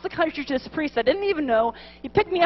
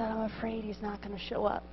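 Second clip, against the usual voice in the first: soft, low confession of a fear; total sincerity; 5 semitones lower, 13 dB quieter than usual. Retold or imitated. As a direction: confess it quietly and earnestly. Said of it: "I looked at him with total sincerity".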